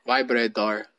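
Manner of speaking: low mock Filipino accent. Host copying partner's word, low mock Filipino accent. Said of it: "do a Filipino accent for me".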